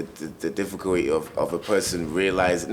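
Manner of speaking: ordinary delivery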